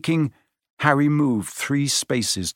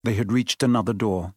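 ordinary delivery

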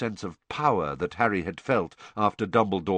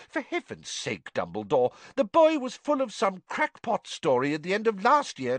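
high, rising angry minister; angry Fudge; 12 semitones higher than usual. A narrator's reading. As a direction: snap back, angry and high. Fudge ranting.

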